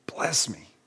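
whispered, broken plea; bitter cry. Acted. Esau begging his father for a blessing, whispered plea. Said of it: "He cried out with a loud and bitter cry".

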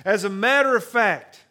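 loud, high, rousing sermon build; fervent pulpit crescendo; preaching at full force. Acted. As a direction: preach it, lift the room.

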